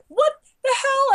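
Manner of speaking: high, loud outraged outburst; outraged shock. Retold or imitated. Fan reading a spoiler message, shocked. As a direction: blurt it out in disbelief.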